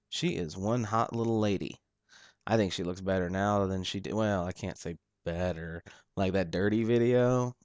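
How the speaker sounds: ordinary delivery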